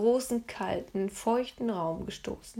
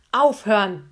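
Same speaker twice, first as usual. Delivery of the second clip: a booming, loud "Stop!"; roaring guard's command; thundering; 9 dB louder than usual. A narrator's reading. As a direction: boom it out like a furious guard. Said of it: "donnerte der mit dem long".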